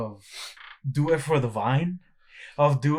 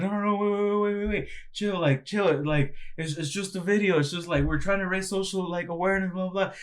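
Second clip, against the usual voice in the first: high, hurried calm-down voice; mock reassuring prankster; placating prankster; 6 semitones higher than usual. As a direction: soothe her in a rushed high voice. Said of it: "And the guy's like".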